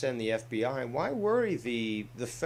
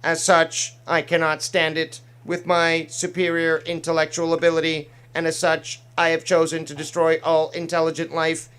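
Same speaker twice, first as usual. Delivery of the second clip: android voice; 4 semitones higher, 7 dB louder than usual. Pretend. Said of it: "my little impressions there".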